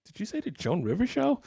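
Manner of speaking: retold disbelief, pitched high with big swings; mock-incredulous double-check. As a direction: question it like you can't have heard right. Retelling his disbelief at booking.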